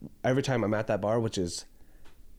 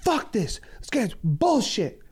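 high, drawn-out mock shouting, breaking into a laugh; imitated drunken yelling; 12 semitones higher, slower than usual. Drunk at the bar cursing fake Rams fans, hammed-up yelling. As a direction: re-enact an outraged sports fan's slow yell. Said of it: "I was yelling".

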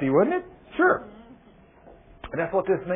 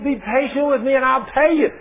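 higher, urgent begging; pleading; 8 semitones higher, 4 dB louder than usual. Acted. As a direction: plead desperately. Debtor pleading again.

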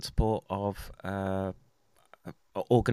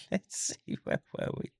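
whispering; 5 dB quieter than usual. Real, not acted. Unvoiced muttering to himself.

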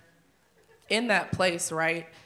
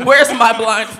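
loud, high mock panic; panicked cry; 4 semitones higher, 13 dB louder than usual. Isaiah calling out in mock panic before God.

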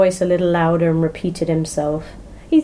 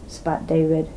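usual speaking voice

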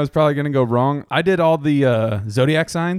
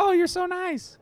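very high, gushing imitation; delighted wife; 3 dB quieter than usual. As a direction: put on a sweet, high-pitched wife voice. Voicing the customer's pleased wife.

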